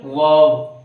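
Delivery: वर्ब as "vaav", final B dropped, low; वर्ब with an open, drawn vowel and no final "b"; silent B demo. Shown as a demonstration. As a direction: say वर्ब without a hard B. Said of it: "बी silent रहेगा".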